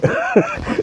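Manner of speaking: short breathy chuckle; quick voiceless laugh; amused laugh. Real, not acted. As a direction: laugh briefly at your own joke. Teller laughs at his own exaggeration.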